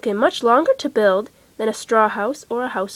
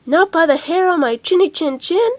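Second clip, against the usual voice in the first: higher, squeaky pig defiance; defiant little pig; 6 semitones higher, 5 dB louder than usual. Acted. The first pig refuses the wolf, high defiant voice. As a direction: refuse like a small brave pig.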